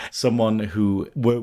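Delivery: usual speaking voice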